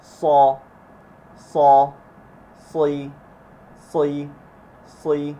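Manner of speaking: emphatic S in "সই", sound sent upward; heavy s. Shown as a demonstration. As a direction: say "স" and "সই" thick and full. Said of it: "উপরের দিকে যাবে". ("স" মোটা করে উপরের দিকে)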